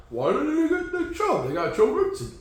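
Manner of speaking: mock-baffled fans asking about the signing; confused fans. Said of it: "They're like".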